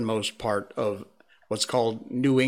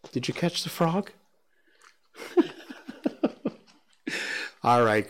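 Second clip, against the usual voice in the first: ordinary delivery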